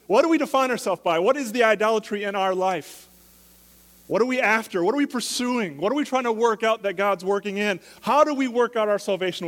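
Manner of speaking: loud, high preaching, driving the point home; preaching at full force. Acted.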